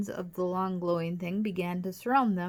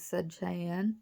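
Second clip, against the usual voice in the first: plain reading voice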